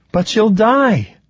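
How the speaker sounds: high, drawn-out cry of protest; distressed protest. A narrator's reading. Ruby pleading with Stoney for Alice's life, distressed.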